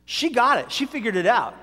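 normal talking voice

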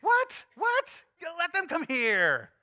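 high shouted what, then slow insistent call; mock outrage, then beckon the kids slowly; incredulous Jesus. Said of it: "he was like".

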